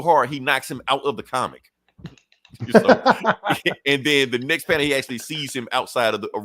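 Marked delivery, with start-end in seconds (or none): 2.8-3.8 sudden high laugh that breaks out; high burst of laughter. Real, not acted. Recapping a comic and cracking up at the joke, high laughter. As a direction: laugh out loud, high and free.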